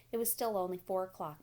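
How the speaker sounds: usual speaking voice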